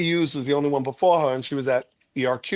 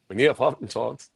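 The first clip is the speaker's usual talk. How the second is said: ordinary talk, nothing marked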